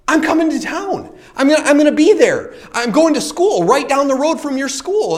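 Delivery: high, excited retold phone call; acting out an eager caller; excited news. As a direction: announce big news eagerly, pitched well up. Retold phone call announcing his move.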